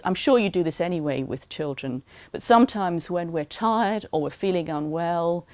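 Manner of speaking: usual speaking voice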